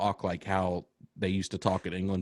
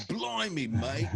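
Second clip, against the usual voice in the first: Blimey, mate in a much higher, hammed-up English accent; jokey cartoon Englishman voice, pitched way up; mock English accent; 5 semitones higher, slower than usual. Friend mocks English slang on podcast, hammed-up English accent. Mocking English people's speech.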